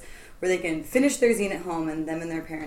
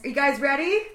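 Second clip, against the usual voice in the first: ordinary talk, nothing marked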